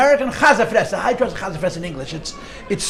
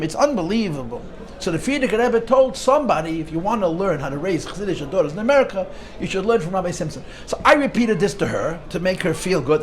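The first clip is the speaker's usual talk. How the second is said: ordinary delivery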